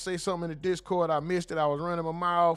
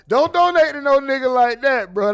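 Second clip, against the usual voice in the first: loud, laughing call-out of a scammer; loud warning; 6 semitones higher, 13 dB louder than usual. Real, not acted. Streamer warning chat about a scammer, loud.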